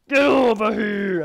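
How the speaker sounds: loud, slow battle cry; shout. Pretend. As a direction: bellow it out to the enemy.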